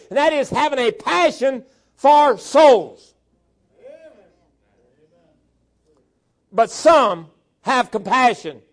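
loud, high, impassioned exhortation; full-force preaching. Real, not acted. Preacher urging a congregation to passion for souls, forceful preaching. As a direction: drive each charge home with rising urgency.

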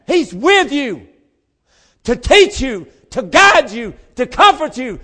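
loud, high, drawn-out shouts of praise; full-force preaching. Real, not acted. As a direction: preach it to the rafters, exultant.

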